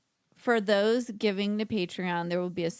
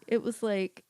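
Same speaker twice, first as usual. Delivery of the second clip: ordinary delivery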